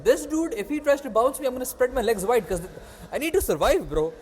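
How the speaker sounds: high, pleading burst of agitation; comic panic. Real, not acted. Telling friend how the big dude frightened him, comic panic.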